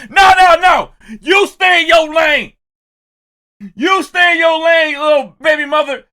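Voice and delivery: loud, high shouting rebuke; imitated shout. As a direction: bark her back into her lane.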